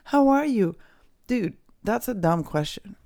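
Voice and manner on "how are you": high, loud sing-song imitation; mock-sweet caller voice; mocking how are you. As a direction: mimic a cloying caller, pitched way up. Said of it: "people call me and they're like".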